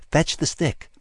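high-pitched prompt to a dog; dog-command tone. A narrator's reading. Someone telling a dog to fetch, coaxing command. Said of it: "in the tone of voice one uses to say".

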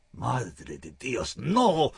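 slow moan rising to a cry; terrified moan. Acted. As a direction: moan in terror. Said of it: "moaned in terror".

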